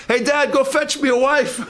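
high, laughing mock request to dad; mock young man. Preacher mimics a young man begging his dad, high and laughing. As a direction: play a cheeky son asking dad for a wife.

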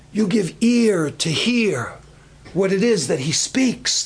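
usual speaking voice

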